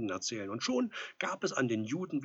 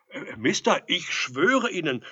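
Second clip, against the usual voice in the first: loud, raised, halting protest; flustered pleading; 7 semitones higher, 12 dB louder, slower than usual. A narrator's reading. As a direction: swear it loudly, tripping at the start.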